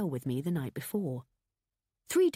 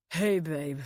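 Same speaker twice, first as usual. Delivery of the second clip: put-on louder drunken slur; slurred greeting; 4 dB louder than usual. A narrator's reading. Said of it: "slurred Adam".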